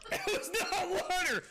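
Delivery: high, shocked yell through coughing; choked outcry. Real, not acted. Streamer discovering it wasn't water, high shocked yell. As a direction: yelp it after a nasty swallow. Drank something not water.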